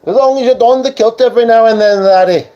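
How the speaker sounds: high, loud jokey Scottish brogue; playful fake Scottish accent; mock Scottish. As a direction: ham up a Scottish uncle calling him laddie. Teasing Scottish put-on.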